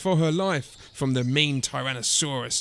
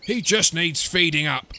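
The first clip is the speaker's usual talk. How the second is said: dad; 4 dB louder than usual. A narrator's reading. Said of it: "said Dad".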